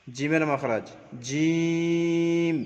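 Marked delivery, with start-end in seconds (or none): 1.3-2.6 জিম held very long and slow, a little higher; long stretched letter. Shown as a demonstration. Teacher shows the drawn-out letter name জিম to students. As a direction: draw জিম out for three alif counts. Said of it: "তিন আলিফ টান হবে".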